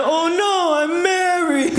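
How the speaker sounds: high, laughing fake gasp; mock surprised wife. Son's new wife to mom: high mock shock. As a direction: play the trapped man's new wife, amused. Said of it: "You're gonna be like".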